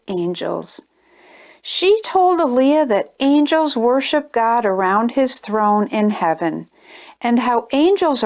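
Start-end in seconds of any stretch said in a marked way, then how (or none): none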